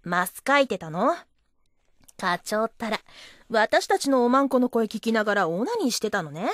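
ordinary talk

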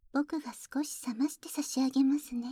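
gentle whisper. Acted. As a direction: hush it close and kindly. (優しいささやき)

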